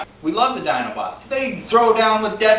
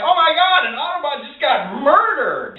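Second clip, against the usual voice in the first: shocked fan; 8 semitones higher than usual. Retold or imitated. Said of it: "And you're like".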